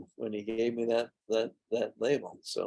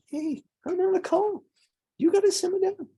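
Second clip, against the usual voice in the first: much higher sing-song voice for the dog; talking to the puppy. Real, not acted. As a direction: coax the puppy, pitch way up. Talking to his restless puppy.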